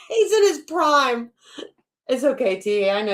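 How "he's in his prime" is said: laughing mock repeat. Real, not acted. Mocking repeat of the seller's line.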